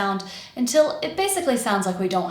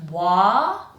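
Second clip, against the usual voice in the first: "Wa" held long, rising; long open "Wa", left hanging; stretched syllable. Shown as a demonstration. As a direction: stretch the vowel of "Wa". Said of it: "stretched, longer".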